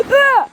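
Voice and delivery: short, high, loud re-enacted shriek; loud mock scream; imitated scream. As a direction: act out the startled scream you just gave. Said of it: "I just screamed at her like".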